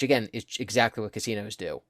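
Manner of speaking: ordinary delivery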